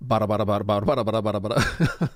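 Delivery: bada bada, a quick galloping beat; galloping drum beat. Shown as a demonstration. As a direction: mouth the double kick like hooves. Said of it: "sort of gallopy thing might evoke engine noise".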